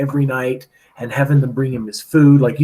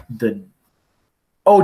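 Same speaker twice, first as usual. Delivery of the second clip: ordinary talk, nothing marked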